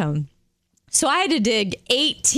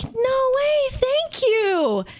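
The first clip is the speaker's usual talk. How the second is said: thrilled thanks; much slower than usual. Retold or imitated. Thrilled at being given something.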